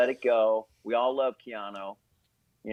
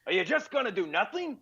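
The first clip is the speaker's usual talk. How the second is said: much higher laughing taunt; taunting Pacino; 10 semitones higher than usual. Retold or imitated. Comedian voices Al Pacino goading Keanu, mock taunt. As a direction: goad him like Pacino egging on Keanu. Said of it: "I go".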